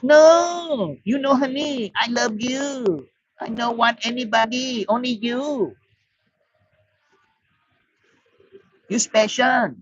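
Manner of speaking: high, soft, sweet-talking pidgin; bar girl's broken English. Retold or imitated. Thai girl soothing the drunk foreigner, in high, soft pidgin English. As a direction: coo at him like a pleading Thai girlfriend. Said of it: "And the girl's".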